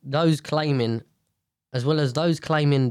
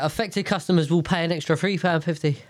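normal talking voice

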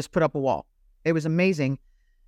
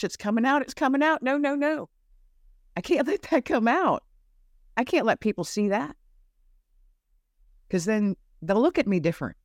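higher, rising panicked inner voice; mock panic; 7 semitones higher than usual. Veteran reliving her panic as memories surfaced, imitated fear. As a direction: replay the alarm of the secret leaking out.

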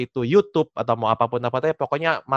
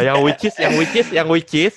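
Jaksel slang; 4 semitones higher, 4 dB louder than usual. Pretend. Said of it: "mocking Jaksel filler".

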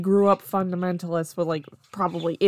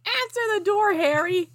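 high-pitched barked command; barked order; 13 semitones higher, 4 dB louder than usual. A narrator's reading. Aunt Petunia ordering Harry from her armchair, high bark. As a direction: call it out sharply, pitched way up. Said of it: "barked from her armchair".

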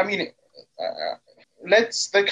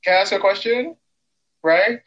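usual speaking voice

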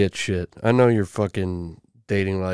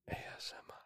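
breathy mock ASMR whisper; 28 dB quieter, much faster than usual. Host mocks ASMR creators in a whisper. Mocking ASMR mid-rant.

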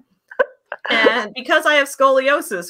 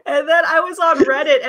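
laughing protest; 10 semitones higher, 4 dB louder than usual. Real, not acted. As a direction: protest while cracking up. Defends herself laughing hard.

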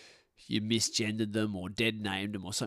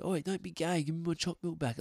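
higher-pitched mock playground complaint; pitched-up imitation of a pestered schoolboy; retold schoolkid protest; 8 semitones higher than usual. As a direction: plead like a kid at school, half-acted.

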